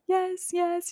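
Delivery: a hint of a pampered little kid; babyish yes. Patient accepting blanket and pillow like a pampered baby. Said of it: "fully getting the full baby treatment".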